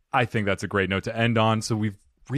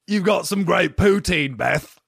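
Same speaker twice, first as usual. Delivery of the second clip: mock British accent; 5 semitones higher, 4 dB louder than usual. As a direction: proclaim it in exaggerated fake British. Continuing the put-on accent riff.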